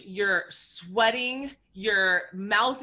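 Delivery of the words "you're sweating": ordinary talk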